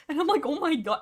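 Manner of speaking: loud, high, laughing exclamation; amused outburst. Real, not acted. Reacting to a funny book scene, amused outburst. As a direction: burst out, tickled by it.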